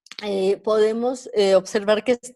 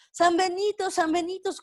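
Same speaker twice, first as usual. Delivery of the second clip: voz aguda de vendedor, sin gritar de verdad; pregón callejero imitado; 7 semitones higher than usual. Retold or imitated. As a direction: pregona como vendedor ambulante, en tono alto. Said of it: "empezó a gritar". (grito de vendedor imitado a media voz)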